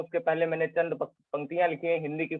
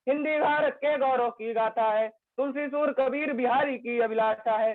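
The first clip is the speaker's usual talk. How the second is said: earnest recitation, repeating key lines; poem recitation; 7 semitones higher than usual. Acted. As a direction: declaim it to the audience with conviction. Recites his Hindi poem.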